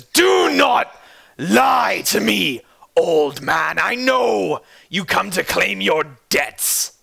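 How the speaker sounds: high, slow, roaring threat; enraged shout, dragged out; furious shout. Acted. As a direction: bellow it at the cowering old man. Roderick roaring at the old man.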